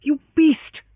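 high, loud furious outburst; girl flames at the hero, furious. Acted. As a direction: fling it at him in fury. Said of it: "she flamed at him".

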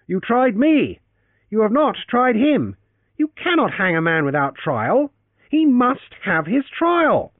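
high, loud protest over the uproar; shouting over the crowd. A narrator's reading. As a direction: yell it out to be heard above the tumult. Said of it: "who shouted through the tumult".

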